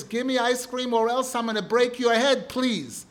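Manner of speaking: loud, high bratty threat with a tacked-on please; a kid blowing up, then grudgingly adding please; over-the-top child tantrum; furious child's threat. Retold or imitated. Child's most extreme threat.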